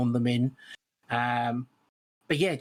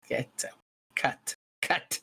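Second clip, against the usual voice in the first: mock dismissal; 4 dB quieter, slower than usual. Mock disgust at co-host's catchphrase.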